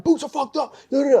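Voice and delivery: high mock corporal bark. Corporal inspecting junior Marines, high mock bark. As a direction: play a hound-dog corporal on inspection. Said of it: "I'm like".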